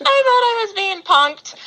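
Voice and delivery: high, laughing through the words. Real, not acted. Telling host about the prank-like call, laughing.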